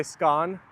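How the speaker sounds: short open vowel in "scone"; "scone" rhyming with gone. Shown as a demonstration. Streamer checking Mick's version, "scone" as skon. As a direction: say "scone" like skon, rising as a question. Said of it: "So you say scone?".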